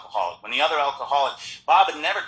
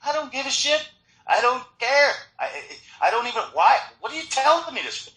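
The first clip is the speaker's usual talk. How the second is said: put-on high-pitched teenage exasperation; acted-out impatient inner rant; exasperated; 12 semitones higher than usual. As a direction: protest in a high, incredulous voice. Seventeen-year-old self's exasperated inner rant.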